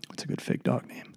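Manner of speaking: unvoiced quick aside; whispered aside. Real, not acted. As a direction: whisper it to yourself.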